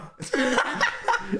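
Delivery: high burst of laughter; laughing. Real, not acted. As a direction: laugh at your own joke. Laughing at own video.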